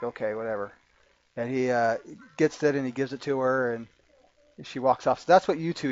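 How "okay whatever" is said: dismissive. Acted. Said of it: "but he's like".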